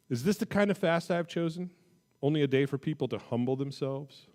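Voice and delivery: expressive scripture reading. Acted. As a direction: read it like God rebuking his people. Reads scripture with inflection.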